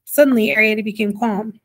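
ordinary delivery, nothing marked

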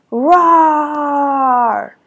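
loud high dinosaur roar, drawn out. A narrator's reading. Reading to children, Sid the dinosaur roars loudly. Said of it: "a dinosaur named Sid".

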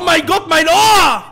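loud startled yelling; pained shout. Real, not acted. Yelling at teammates about his blasted ear. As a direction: shout over the blown-out mic. Mic overdriven, yelling in pain.

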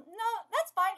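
ordinary delivery, nothing marked

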